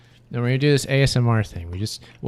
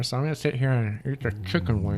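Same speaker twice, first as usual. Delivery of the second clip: ASMR voice; 9 dB quieter than usual. Pretend. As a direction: murmur it close to the mic. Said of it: "They want A S M R".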